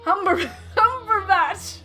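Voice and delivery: laughing. Real, not acted. Hammy announcer crowd intro.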